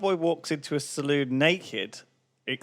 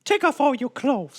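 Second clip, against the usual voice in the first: much higher, seductive woman; high woman's voice; 10 semitones higher than usual. Acted. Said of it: "She says".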